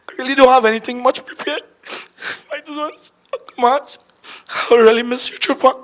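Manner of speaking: tearful farewell. Real, not acted. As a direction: say goodbye while holding back tears. Tearful farewell to dead uncle.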